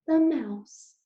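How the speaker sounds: usual speaking voice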